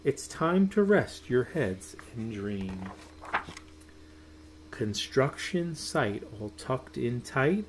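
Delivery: ordinary delivery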